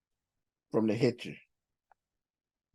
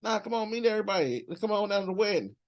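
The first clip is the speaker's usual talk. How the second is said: higher-pitched, quick coaxing dad; lightly voiced dad; 6 semitones higher, faster than usual. Dad calling his son down to the wedding, quick higher coaxing. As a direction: lightly act a dad urging someone along. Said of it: "his dad was like".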